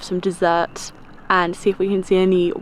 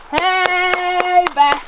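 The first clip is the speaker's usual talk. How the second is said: calling out to a bear; 9 semitones higher, 3 dB louder than usual. Shown as a demonstration. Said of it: "Just warding them off".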